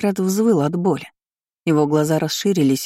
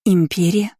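a mild gasp of disbelief; 3 semitones higher than usual. A narrator's reading. Heroine learning of the unknown empire, gasping. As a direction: catch your breath on the word. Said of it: "ахнула я".

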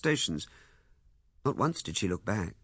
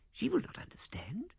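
whisper; 13 semitones higher, 7 dB quieter than usual. Acted. Bitter aside in plea.

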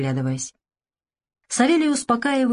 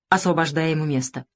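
plain reading voice

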